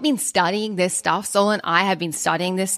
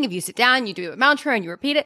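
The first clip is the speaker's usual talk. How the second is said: ordinary talk, nothing marked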